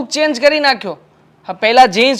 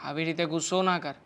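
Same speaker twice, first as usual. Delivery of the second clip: much softer, calming plea; 5 semitones lower, 13 dB quieter than usual. Acted. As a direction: hush her anger gently. Husband calming his angry wife. (ધીમા અવાજે શાંત પાડતી વિનંતી)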